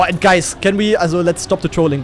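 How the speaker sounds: ordinary talk, nothing marked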